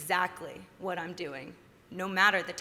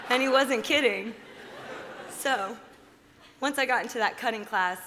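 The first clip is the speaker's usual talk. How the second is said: laughing; 4 semitones higher, 4 dB louder than usual. Real, not acted. Laughing after telling the joke.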